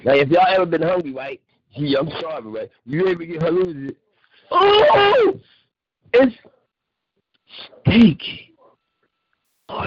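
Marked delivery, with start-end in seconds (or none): 4.5-5.4 long, high, loud "oh"; drawn-out wail at the food; amazed wail. Real, not acted. Hungry host reacts to food.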